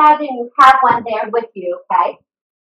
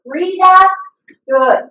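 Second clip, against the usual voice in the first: usual speaking voice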